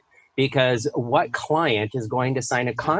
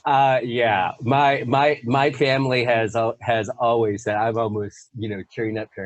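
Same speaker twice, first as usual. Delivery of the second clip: usual speaking voice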